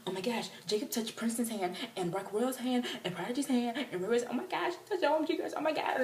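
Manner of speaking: high, gushing run-on excitement; building squeal of fan excitement; fangirl frenzy. Retold or imitated. Acted fans thrilled over touched hand.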